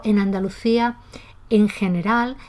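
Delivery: ordinary delivery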